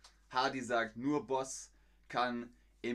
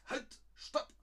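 imperative command. Shown as a demonstration. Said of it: "Halt ist Imperativ".

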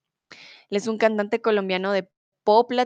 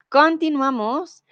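higher, louder and drawn out; upbeat announcement; 7 semitones higher, 5 dB louder, slower than usual. Real, not acted. Teacher announcing the next song to the class, upbeat and drawn out. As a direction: announce the next topic brightly.

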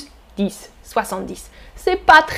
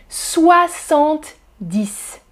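soixante-dix said slowly again, rising at the end; slow repeat; much slower than usual. Shown as a demonstration. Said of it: "on dit". (répété lentement, intonation montante)

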